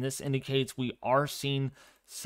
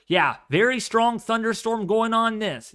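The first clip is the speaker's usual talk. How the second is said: loud, high, hyped-up delivery; hyped-up streamer voice; 9 semitones higher, 7 dB louder than usual. Shown as a demonstration. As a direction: belt it out like a hyped storm chaser. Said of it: "how I talk is like this".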